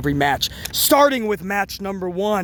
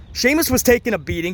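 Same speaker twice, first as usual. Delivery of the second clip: ordinary talk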